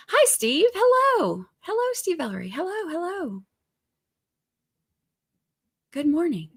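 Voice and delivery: high sing-song hellos; singsong greeting. Real, not acted. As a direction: greet viewers in a lilting high voice. Greeting chat viewers singsong.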